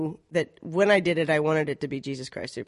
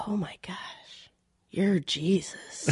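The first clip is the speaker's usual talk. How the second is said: retold whisper; 3 semitones higher, 4 dB quieter, slower than usual. Retelling awestruck first meeting.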